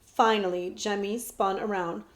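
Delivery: ordinary delivery, nothing marked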